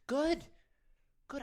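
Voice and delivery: a sudden high, loud "Good."; excited praise. Real, not acted. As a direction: cheer the listener on. Speaker cheering the listener on.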